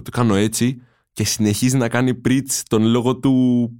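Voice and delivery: normal talking voice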